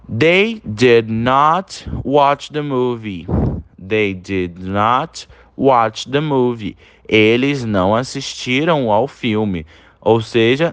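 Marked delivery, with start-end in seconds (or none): 0.1-6.8 each English word said slowly and evenly; very slow model reading. Shown as a demonstration. Teacher reading the example to students, very slowly. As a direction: read it slowly for repetition. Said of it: "Olha o segundo exemplo".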